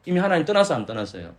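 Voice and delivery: ordinary delivery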